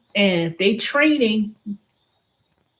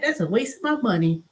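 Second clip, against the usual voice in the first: ordinary talk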